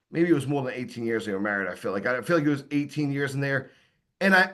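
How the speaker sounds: ordinary talk, nothing marked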